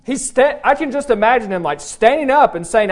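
ordinary talk

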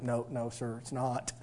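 very quiet, low reply to dad; retold meek answer, almost under the breath; sheepish denial.

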